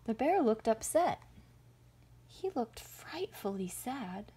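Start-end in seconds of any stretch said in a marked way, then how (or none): none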